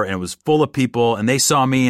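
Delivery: plain reading voice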